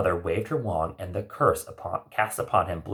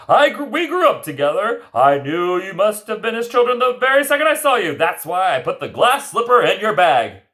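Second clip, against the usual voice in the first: Lambton voice; 11 semitones higher, 7 dB louder than usual. Acted. Lambton character voice.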